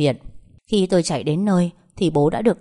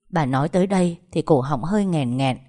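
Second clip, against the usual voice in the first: usual speaking voice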